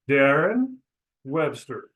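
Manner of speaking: Darren rising, Webster falling; rise then fall. Shown as a demonstration. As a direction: lift Darren, let Webster drop. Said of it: "your voice should go down".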